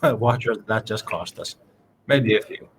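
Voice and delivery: ordinary talk, nothing marked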